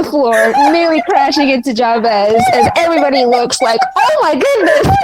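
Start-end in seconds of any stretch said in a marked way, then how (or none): 4.0-5.0 shocked gasp. Acted. Onlookers gasp at the fall.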